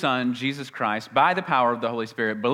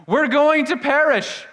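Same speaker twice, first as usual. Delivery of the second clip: much higher, louder cry of fear; panicked fishermen; 13 semitones higher, 8 dB louder than usual. Acted. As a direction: cry out in terror of the storm. Frightened fishermen in storm.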